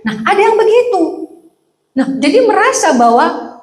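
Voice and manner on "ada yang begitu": usual speaking voice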